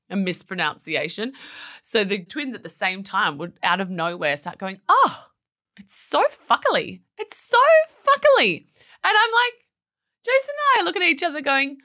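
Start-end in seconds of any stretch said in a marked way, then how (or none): 4.9-8.7 put-on high childish mispronounced fuckily; high little-kid voice; toddler voice. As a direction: chirp fuckily like a little twin.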